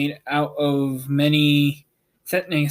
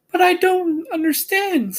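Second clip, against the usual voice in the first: put-on high, girlish and puzzled; a little girl who can't make sense of it; bewildered Dorothy. A narrator's reading. Dorothy puzzled by Oz's confession, high girlish voice. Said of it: "said Dorothy in bewilderment".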